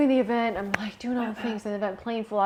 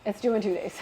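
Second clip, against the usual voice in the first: ordinary talk, nothing marked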